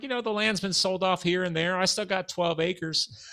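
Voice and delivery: high imitation Southern voice, slowing at the end; Southern accent. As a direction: retell it as an old Southerner. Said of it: "I can't do a good Southern accent".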